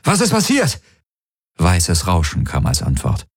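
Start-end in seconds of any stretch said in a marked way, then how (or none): 0.0-0.8 high, frightened cry; panicked call. Acted. Panicked call into dead comm. (panischer Ausruf)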